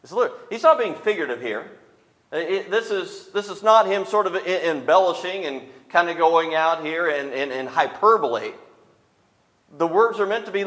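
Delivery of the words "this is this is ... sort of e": ordinary delivery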